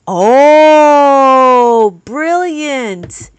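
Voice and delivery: long, loud dawning "oh"; loud, thrilled discovery; delighted realization. Real, not acted. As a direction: draw out a loud oh, then marvel. Struck by viewer's wax idea.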